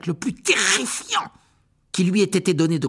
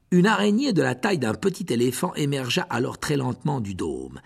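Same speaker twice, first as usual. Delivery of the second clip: plain reading voice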